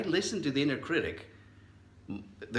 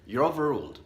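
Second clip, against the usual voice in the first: firm judge's ruling, a bit higher and louder; 5 semitones higher, 5 dB louder than usual. Pretend. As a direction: declare it from the bench. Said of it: "Just like a judge would say".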